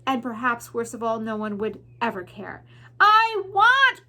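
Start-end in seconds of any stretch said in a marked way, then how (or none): 3.0-4.1 high, loud wail; anguished cry. Acted. Mig crying out in the dungeon, high loud wail. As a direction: cry it out from the gut. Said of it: "cried Mig".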